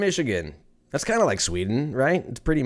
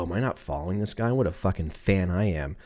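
ordinary talk

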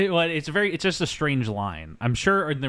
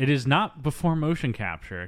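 ordinary talk

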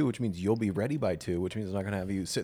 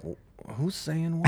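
confused mock; 8 semitones higher, much slower than usual. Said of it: "they're like".

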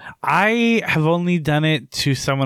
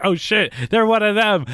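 higher-pitched imitation of panicked realisation; mock alarm; 8 semitones higher than usual. Movie-goer spots a body snatcher, mock alarm. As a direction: act out a sudden scare, pitched up.

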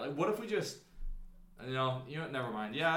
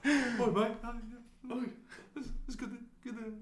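quiet, higher put-on Aussie voice; mock Australian accent; 5 semitones higher, 6 dB quieter than usual. Co-host greeting in soft mock Australian accent. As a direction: mumble like a friendly bloke at the pub. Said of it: "I can't do those accents".

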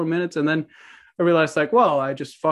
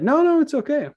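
higher, soothing no no; reassuring clerk; 11 semitones higher than usual. Retold or imitated. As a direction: play a clerk calming a worried caller. Court clerk reassures him on phone.

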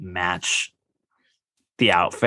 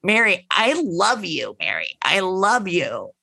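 high, drawn-out plea; lightly voiced pleading; mock pleading; 8 semitones higher than usual. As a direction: beg for her friend's love, hammed up. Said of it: "Whitney going like".

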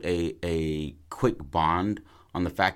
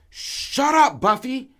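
loud, very high-pitched shout; acted angry yell; 8 dB louder, slower than usual. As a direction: yell at a barking dog. Character yells at barking dog.

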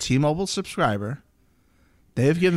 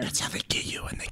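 whispered aside; 11 dB quieter, faster than usual. Acted. Mock secret aside.

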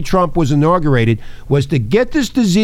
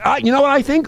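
normal talking voice

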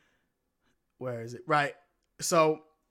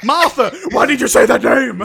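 loud, high mock-outraged yell; shouting; 6 semitones higher, 13 dB louder than usual. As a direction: bellow it in hammed-up fury. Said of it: "and he shouts".

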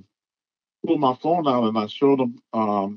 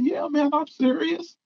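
higher imitated friend, earnest; friend insisting. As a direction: mimic the friend swearing he means it. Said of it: "He's like".

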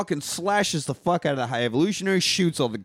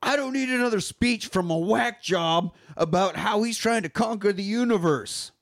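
higher-pitched, indignant retelling of a hero; Quill's exasperated rebuke; 8 semitones higher than usual. Retold or imitated. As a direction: act out a fed-up movie hero. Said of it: "Quill's talking to the High Evolutionary".

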